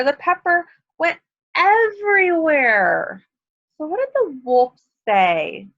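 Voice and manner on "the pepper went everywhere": dramatic exclamation. A narrator's reading. Pepper spills everywhere.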